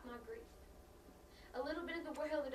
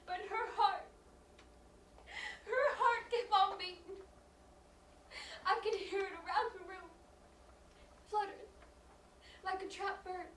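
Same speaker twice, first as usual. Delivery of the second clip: grief-stricken narration; 6 semitones higher, 8 dB louder than usual. Acted. Mother recounts child's still-beating heart, grieving. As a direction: recount it through held-back tears.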